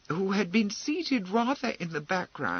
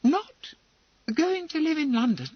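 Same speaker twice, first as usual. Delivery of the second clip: much higher, surprised question; a young bride's startled question; surprised, uplifted voice; 13 semitones higher, 3 dB louder than usual. Acted. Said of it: "surprised Emily into uplifting her voice".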